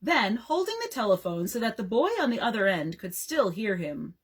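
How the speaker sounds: ordinary delivery, nothing marked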